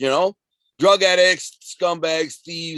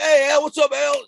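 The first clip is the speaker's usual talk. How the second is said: much higher, louder, overly friendly hello; mock eager greeting; 14 semitones higher, 6 dB louder than usual. Kids who now respect him greeting him, in a mock eager high voice. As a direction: ham up a fawning kid saying hi.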